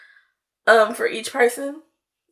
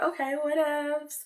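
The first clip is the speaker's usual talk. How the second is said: dismissive; 6 semitones higher, 9 dB quieter than usual. Retold or imitated. Brushing off his denial.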